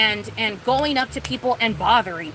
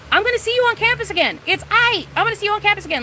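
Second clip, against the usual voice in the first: high sing-song; 9 semitones higher, faster than usual. Pretend. Reassuring herself, mock high voice.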